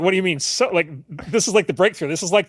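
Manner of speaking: incredulous excited dad, lightly replayed; excited disbelief. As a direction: pitch it up like a dad who can't believe his kid shrugged. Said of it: "And I was like".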